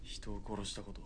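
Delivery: quiet menace. Acted. Soldier quietly challenges corporal.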